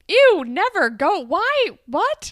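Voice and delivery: high, loud volley of one-word retorts; mock disgusted rejection. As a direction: act out appalled refusal, punching each word. Acts out rejecting Peter's plea.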